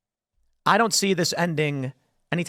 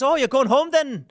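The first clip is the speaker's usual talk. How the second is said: high, put-on, mock-innocent question; imitating the Irish guy; 8 semitones higher, 4 dB louder than usual. Irish bystander asking Syrians about going home, high mock-innocent. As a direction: ask it like it's the obvious next step.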